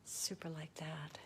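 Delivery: awed whisper; soft whispered aside. Real, not acted. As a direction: breathe it out in hushed admiration.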